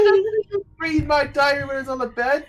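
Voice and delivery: high, fast, loud outraged yell; outraged shout. Acted. Alara yells at Seren for reading her diary. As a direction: shriek it in disbelief.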